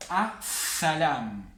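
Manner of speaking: very slow 'A Salam' with long double s; doubled first letter. Shown as a demonstration. Teacher demonstrates the solar rule, stretched s in 'A Salam'. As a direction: hold the s in 'A Salam', skipping the L. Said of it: "la vamos a pronunciar doble".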